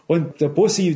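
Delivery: ordinary delivery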